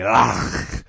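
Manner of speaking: "loch" with a long, scraped throaty ch; spray the ending of "loch"; exaggerated guttural finish on "loch"; spitty Scottish loch. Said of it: "spit is coming onto my ears".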